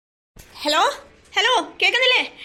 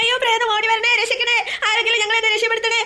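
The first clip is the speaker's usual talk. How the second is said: loud, high hammed-up cries for help; calling for help; 5 semitones higher, 4 dB louder than usual. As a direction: yell for rescue in mock panic. Calling out for rescue.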